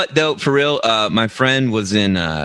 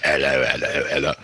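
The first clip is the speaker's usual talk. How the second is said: British bobby; 8 semitones lower, 3 dB quieter, faster than usual. Pretend.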